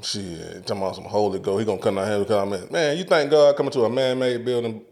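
ordinary talk

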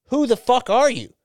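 high, emphatic retold challenge; exasperated demand. Stranger pressing the Australian behind the rope, exasperated. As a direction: imitate a stranger demanding an explanation.

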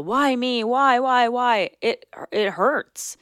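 high, pleading why me; victim whine. Shown as a demonstration. Host demonstrating the victim mentality to listeners, whiny and pleading. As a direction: whine it like a self-pitying victim. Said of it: "Even when I do it now to demonstrate it".

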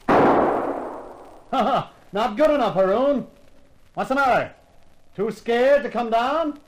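loud, mocking challenge up the steps; loud taunting. Hero taunting the prince to come down, loud and mocking. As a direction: goad him, loud and cocky.

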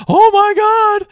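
very high, loud gasped "oh my God"; shocked mom. Retold or imitated. Mom holding the camcorder, shrieking in alarm. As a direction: shriek it like a stunned mother. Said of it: "She's like".

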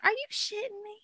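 high incredulous squeal. Retold or imitated. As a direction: act out total disbelief. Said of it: "I'm just like".

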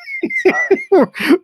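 a sudden, high-pitched real laugh; high burst of laughter. Real, not acted. Host laughing at long intros.

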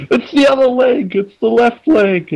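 high falsetto whimper; squealed, play-acted agony; mock pained protest. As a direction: plead in a hammed-up squeaky voice. Acting out man whacked on leg.

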